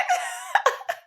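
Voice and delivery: high airy laugh bursting out; laughing at the memory of dad; real laughter. Real, not acted. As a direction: laugh it off freely. Laughing at dad's reaction.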